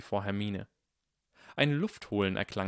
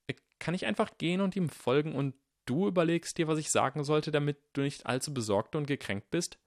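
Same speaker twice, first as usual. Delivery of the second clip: put-on higher, nervous boy; awkward boy pleading; young boy voice; 7 semitones higher than usual. A narrator's reading.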